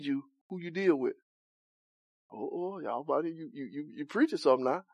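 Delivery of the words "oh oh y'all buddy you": ordinary delivery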